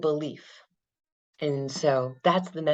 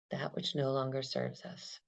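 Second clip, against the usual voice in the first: calm, unhurried meditation guide; guided meditation; 2 semitones lower, 5 dB quieter than usual. Acted. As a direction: lead them gently through the breath. Guided body-scan meditation.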